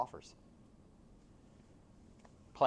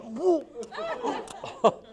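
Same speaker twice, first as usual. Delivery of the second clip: mock shocked gasp; 7 dB louder than usual. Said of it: "I went in and I was like".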